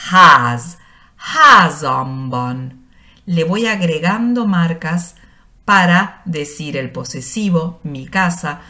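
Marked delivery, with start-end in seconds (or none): 0.1-2.8 loud, very slow "ház, házamban" with stress on the first syllable; spelling out a Hungarian word for learners; Hungarian demo. Shown as a demonstration. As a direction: build up "házamban" slowly from "ház". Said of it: "en húngaro yo nombro la palabra base".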